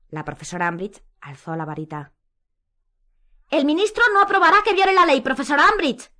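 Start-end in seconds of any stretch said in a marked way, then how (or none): 3.5-6.1 high, loud protest; Hermione shouting. A narrator's reading. Hermione shouting at Umbridge about the law. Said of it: "volvió a gritar Hermione".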